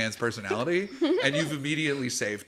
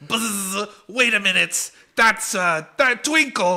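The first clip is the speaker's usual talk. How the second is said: higher, louder buzzing accusation; bee spotting Twinkles; 7 semitones higher, 5 dB louder than usual. Acted. Bee recognizing Twinkles among the party, buzzing and loud. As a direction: buzz and point him out.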